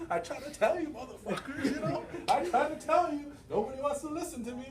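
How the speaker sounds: high, laughing mock complaint; laughing rant. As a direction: laugh it off walking away. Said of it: "going back to the car like".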